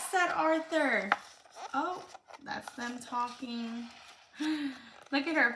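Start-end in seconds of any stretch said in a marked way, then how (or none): 0.1-1.1 put-on reading a picture book aloud to a child; lively read-aloud for a little one; storybook reading.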